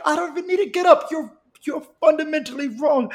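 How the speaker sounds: high-pitched, quick protest; retold indignant retort. Acted retort to threat.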